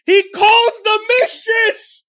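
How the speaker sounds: loud, high, drawn-out exclamation; real incredulous cry, stretched and high; scandalized outburst. Real, not acted. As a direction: belt out the outrageous reveal slowly. Teller reveals the scandalous twist.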